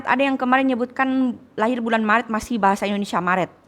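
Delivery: usual speaking voice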